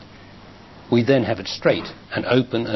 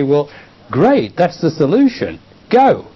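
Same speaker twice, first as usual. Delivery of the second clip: breezy dismissal; 5 semitones higher, 6 dB louder, slower than usual. Retold or imitated. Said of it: "then you say".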